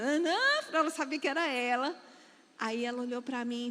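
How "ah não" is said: "Ah, não" lifted as a pointed cue; exaggerated cue intonation. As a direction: swing "Ah, não" up to signal someone's turn. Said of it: "aí eu fiz aquela entonação".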